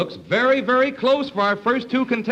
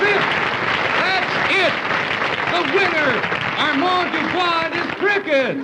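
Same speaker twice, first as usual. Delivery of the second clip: excited announcing; 9 semitones higher than usual. Acted. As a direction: crow out the winner like a showman. Host announces contest winner.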